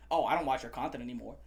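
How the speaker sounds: low, quick dismissive mock voice; mocking Boogie. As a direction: sneer it out like a sulky dismissal. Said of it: "he's like".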